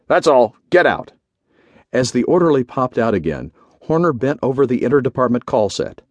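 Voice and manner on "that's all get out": curt, firm send-off; grunted dismissal. A narrator's reading. General sends the orderly out, grunting. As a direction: dismiss him brusquely. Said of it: "he grunted".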